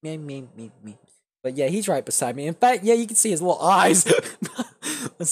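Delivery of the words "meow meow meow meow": soft imitated meow. Imitating cat again.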